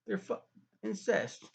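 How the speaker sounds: high, hammed-up outrage over the reveal; sarcastic mock shock. Discovering the twins' incest, mock-scandalized high exclamations. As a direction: play the scandal up, tongue in cheek. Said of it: "being sarcastic".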